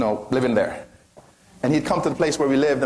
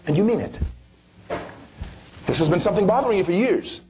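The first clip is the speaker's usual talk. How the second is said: ordinary delivery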